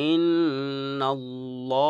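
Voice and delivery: ইন্নাল্লাহ drawn out very slowly, heavy ল; recited heavy. Shown as a demonstration. A Quran teacher to students, reciting a heavy example. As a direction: chant ইন্নাল্লাহ slow and full. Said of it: "যেমন".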